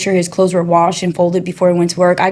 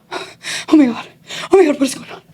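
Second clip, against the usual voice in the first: higher, breathless retold panic; panicking; 7 semitones higher than usual. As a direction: gasp it out as the scared kid in the car. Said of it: "I'm panicking".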